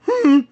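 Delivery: sudden high loud muffled hum; panicked muffled hum. Retold or imitated. Mouthless hero panicking in the retold scene, high muffled hum. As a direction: squeal through a shut mouth in panic. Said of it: "he panics and, like, goes".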